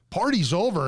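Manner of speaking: imitating a cop shutting down the party; mock cop. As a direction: bark it like a cop breaking up a party.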